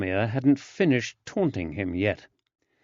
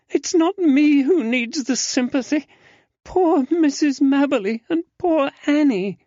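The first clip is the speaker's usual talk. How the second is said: put-on much higher, louder young woman in dismay; distressed young lady; 6 dB louder than usual. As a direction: cry out like a shocked young lady. Susanna after crying.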